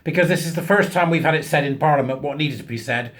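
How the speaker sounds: ordinary talk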